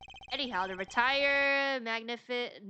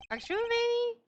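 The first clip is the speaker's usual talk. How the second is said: much higher, squeaky mock question; 13 semitones higher, 3 dB louder, slower than usual. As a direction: pitch the question way up to mock him. Mocking a courtroom character.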